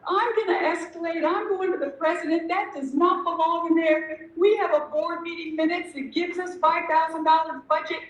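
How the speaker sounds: vowing to go over her head; indignant rant. Retold or imitated. Ranting to the committee about escalating, indignant. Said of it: "she left the country for a month".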